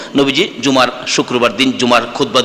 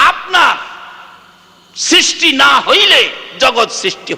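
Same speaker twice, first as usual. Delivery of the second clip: pleading sermon shout, pitched high; fiery preaching; 9 semitones higher than usual. Acted. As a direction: thunder the warning at the crowd. Preacher's impassioned curse list.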